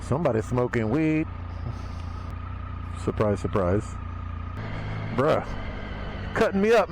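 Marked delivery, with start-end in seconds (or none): none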